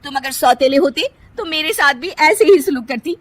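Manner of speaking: mock the mother-in-law's love; scornful. Kirti scorns mother-in-law. (सास पर तंज़ करती पत्नी)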